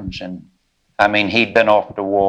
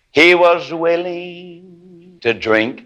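sing-song sermon peak, soaring high; whooping preacher; 9 semitones higher, much slower than usual. Real, not acted. Closing whoop over music.

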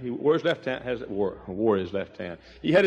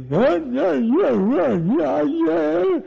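high, louder, strained cries of joy; imitating Urban; 13 semitones higher, 5 dB louder, much slower than usual. Preacher imitating Urban's strained, high, excited yeahs. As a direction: mimic the afflicted man's excited yeahs.

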